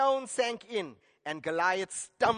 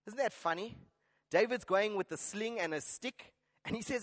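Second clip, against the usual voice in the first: ordinary talk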